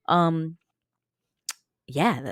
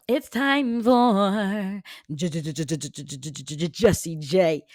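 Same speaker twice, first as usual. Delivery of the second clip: hyped segment announcer; 3 semitones higher, 6 dB louder, slower than usual. Pretend. Host introducing the Geo News segment, hyped announcer voice. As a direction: draw it out like a radio show intro.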